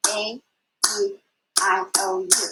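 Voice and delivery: each vowel said apart, one per clap; clapped vowels. Shown as a demonstration. Teacher to class, clapping out the vowels. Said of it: "Let's clap those vowels".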